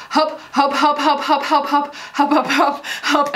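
put-on "help" repeated over and over, mumbled like hup, hope, by a small child; imitating her toddler. Mom imitating her little son's endless help. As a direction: rattle off "help" nonstop like a toddler. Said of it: "and he just goes".

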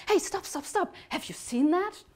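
high, urgent little angel voice; excited. Acted. Little angel stopping the bear mid-trick, high excited outburst. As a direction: cry out excitedly, pitch jumping high. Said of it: "the little angel got excited".

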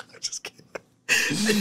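drawn-out whisper through laughter; breathless laughing whisper; wheezing whisper. Real, not acted. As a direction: squeeze it out voiceless, laughing. Whispered retraction amid laughter.

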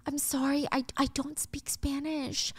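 slow, hesitant acted excuse; creeped-out apology. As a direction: back away politely, a touch uneasy. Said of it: "Clay is kind of creeped out".

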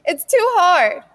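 high, loud crying plea; a child breaking into tears; sobbing it's too hard. Acted. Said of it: "never seen Sassy cry so fast".

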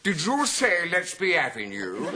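high, loud, drawn-out query; challenging passenger. Retold or imitated. Passenger on the stairs to conductor, challenging. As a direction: demand it slowly, as if affronted. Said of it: "this man coming down the stairs said".

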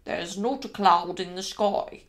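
low, slow manservant; Lane's voice. Acted. Manservant reassuring his wife about the weather, low and slow.

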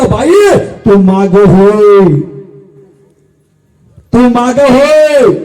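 long, stretched-out order; slow declaimed command; commanding mother-in-law. Acted. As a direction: call her back with slow, drawn-out words. Mother-in-law orders wife back.

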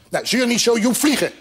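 high, cross little bird; irritated high command; angry. A narrator's reading. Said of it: "Het vogeltje werd nu echt boos".

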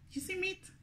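high, soft surprised question. Real, not acted. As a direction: sound amazed at what you find. Surprise at food.

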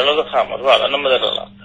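ordinary talk, nothing marked

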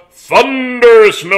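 drawn-out, loud, high wrestling announcer call; slow booming wrestler intro; ring announcer boom. Pretend.

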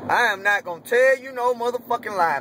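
ordinary talk

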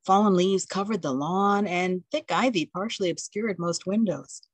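ordinary delivery

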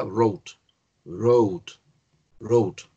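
"wrote" with silent w and long o; pronunciation of wrote. Shown as a demonstration. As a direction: model "wrote" singly, like "rote". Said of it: "el pasado se pronuncia".

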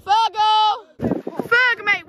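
high, loud call across the beach; calling from far away. Real, not acted. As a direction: yell his name to reach him.

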